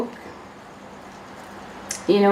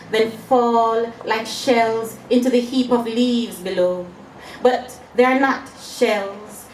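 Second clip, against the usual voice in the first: raised, deliberate poem reading with drawn-out phrases; poetry recital; 4 semitones higher, slower than usual. Acted. Poet reads her fish-scaling poem to an audience, poetry recital. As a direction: recite it image by image, with weighted pauses.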